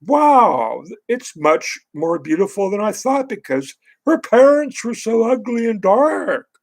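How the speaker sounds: sarcastic gushing. Retold or imitated. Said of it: "I'm gonna go think that".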